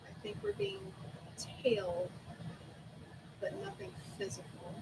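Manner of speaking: quiet. Acted. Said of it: "As quietly as I can".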